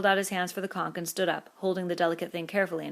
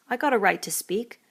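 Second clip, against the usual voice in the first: hurt; 4 semitones higher, 6 dB louder than usual. A narrator's reading. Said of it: "said Piggy in a hurt voice".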